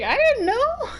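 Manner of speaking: high-pitched, laughing protest; giggly retold surprise; amused disbelief. Laughing over her own late realization.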